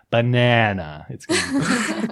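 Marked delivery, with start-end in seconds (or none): 0.1-1.1 "Banana" drawn out on the middle syllable; exaggerated usual stress. Imitating friends failing the challenge, overstressed middle of "Banana". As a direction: say "Banana" as a long ba-NAA-na. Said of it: "watch them say".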